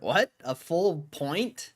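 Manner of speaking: high, loud, stretched-out disbelief; can't believe the headline, drag out full point; shocked disbelief. Real, not acted. Reacting to a rate-cut headline.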